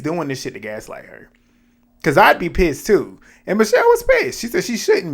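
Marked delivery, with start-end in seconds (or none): 2.0-5.1 louder, much higher, fired up; indignant. Real, not acted. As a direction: let the outrage spike. Outraged on her behalf.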